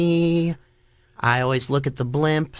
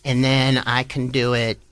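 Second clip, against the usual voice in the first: ordinary talk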